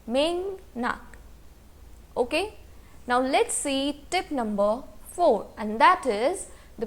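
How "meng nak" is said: "meng nak" with ng and n linking the syllables; teacher's repeat of "meng nak" with nasal join; nasalised Korean pronunciation. Shown as a demonstration. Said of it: "it will be pronounced as".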